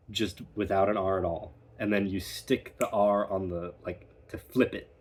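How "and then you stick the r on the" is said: normal talking voice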